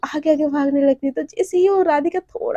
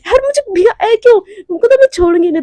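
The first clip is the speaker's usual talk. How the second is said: high, loud outraged cry after a soaking; shocked yell; 8 semitones higher, 9 dB louder than usual. Acted. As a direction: scream it like you were just dumped in cold water. Dunked into water bucket. (भीगने पर चौंककर ऊँची ज़ोरदार चीख)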